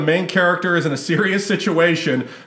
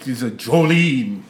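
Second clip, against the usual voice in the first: vampire voice; 4 semitones lower, slower than usual. Acted. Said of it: "Dracula's go-to karaoke song".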